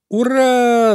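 high, loud, stretched cheer; jubilant shout. A narrator's reading. Friends cheer the hare's release, shouting joyfully. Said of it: "закричали все в один голос".

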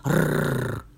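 low rolled growl; low wolfish rumble; hoarse growl. A narrator's reading. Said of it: "хрипло зарычал".

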